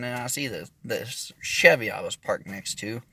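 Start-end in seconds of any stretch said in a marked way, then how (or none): none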